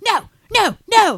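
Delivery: high, insistent no's; Austin quote. Retold or imitated. Retelling Austin's camera scene, high squealing no's. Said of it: "he goes".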